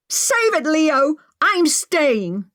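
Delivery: high shouted defiance; screaming. A narrator's reading. Maggie screaming at Leo that she stays. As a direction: scream him down. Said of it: "Maggie screamed".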